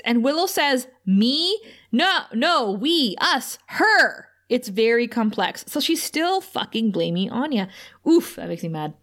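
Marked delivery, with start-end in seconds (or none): 1.1-4.3 high, halting mock protest; stammered high-pitched denial, acted out; flustered blame-shifting. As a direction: imitate someone squirming, each word its own rising attempt. Retelling Willow flustered, shifting the blame.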